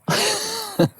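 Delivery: short high laugh. Real, not acted. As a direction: burst out laughing. Laughs about the Asheville coincidence.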